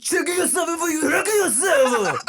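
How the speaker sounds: high, loud hammed-up threat; mock street tough; old slang tough guy. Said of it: "sixties slang".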